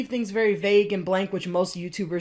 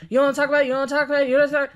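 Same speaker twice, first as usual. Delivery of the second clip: nagging, more insistent and louder; 5 semitones higher, 5 dB louder, much faster than usual. Retold or imitated. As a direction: push the nagging louder now. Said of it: "Fifteen minutes later".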